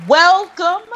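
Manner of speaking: high, loud, bright welcome; excited welcome. Real, not acted. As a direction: belt out the welcome. Host's big welcome call.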